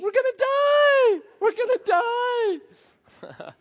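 imitated screaming panic; panicked scream. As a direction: scream in hammed-up terror like a snapped kid.

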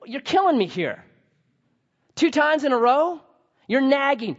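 mock exasperated outburst; exasperated complaint. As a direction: throw up your hands at being nagged twice. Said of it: "if I'm Jesus at that point, I'm like".

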